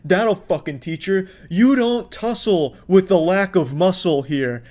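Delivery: mock-admiring, drawn-out big-man bravado; sarcastic hype. Commentator mocking an angry shopper's victory, sarcastic hype. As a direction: hype him up like a wrestling champion, tongue in cheek.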